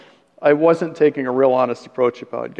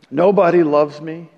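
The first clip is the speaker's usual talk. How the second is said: self-pitying mutter. Retold or imitated. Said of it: "That's the kind of muttering".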